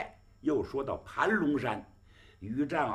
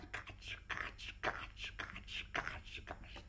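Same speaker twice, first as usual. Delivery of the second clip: creaking sound; 15 dB quieter, faster than usual. Retold or imitated. Sedan chair creaking sound.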